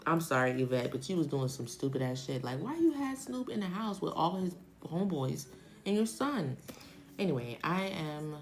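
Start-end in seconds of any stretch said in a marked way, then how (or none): none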